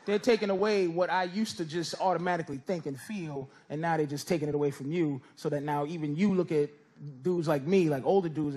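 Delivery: ordinary talk, nothing marked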